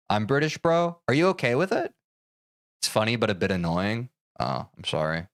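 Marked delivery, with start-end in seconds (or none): none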